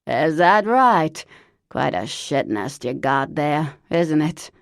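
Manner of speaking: loud, put-on voice of an old chain smoker; Lavinia's horrible voice. Cousin Lavinia phoning Parker, loud put-on smoker's voice. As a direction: bark it out like a lifelong smoker.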